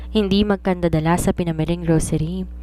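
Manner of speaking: plain reading voice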